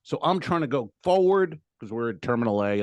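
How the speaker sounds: ordinary delivery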